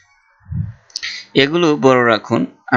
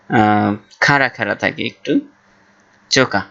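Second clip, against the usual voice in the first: usual speaking voice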